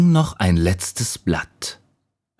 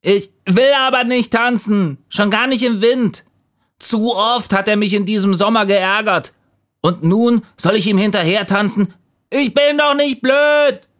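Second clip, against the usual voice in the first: high, indignant little leaf; defiant refusal; 3 dB louder than usual. A narrator's reading. Leaf refuses to dance with the wind, high indignant voice. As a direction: protest stubbornly in a very high voice.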